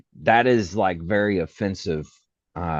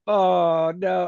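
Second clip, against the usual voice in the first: mortified gasp; 9 semitones higher than usual. Retold or imitated. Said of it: "And I was like".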